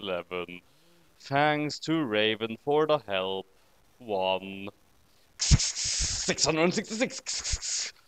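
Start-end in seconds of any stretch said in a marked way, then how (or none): 5.4-7.9 slow, breathy devil whisper; a slow unvoiced spooky whisper; demonic whisper. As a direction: hiss the number like a demon. Spooky number in silly reading.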